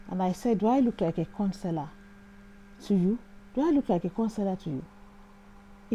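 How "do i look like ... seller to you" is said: ordinary delivery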